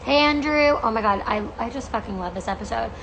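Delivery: ordinary talk, nothing marked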